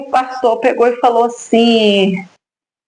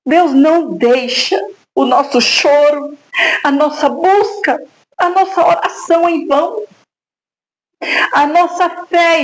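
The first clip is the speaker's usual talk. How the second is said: earnest preaching; 5 semitones higher than usual. Real, not acted. Mother exhorting the brethren, earnest preaching.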